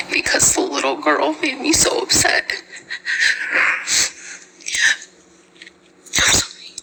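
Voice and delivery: real tears, whispered high and breaking; barely holding back crying; tearful whisper. Real, not acted. Mother recounting her son's hungry cry, whispering through tears. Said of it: "this next part is why I'm so emotional".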